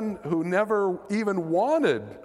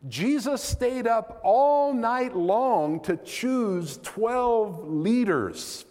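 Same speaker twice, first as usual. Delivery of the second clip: normal talking voice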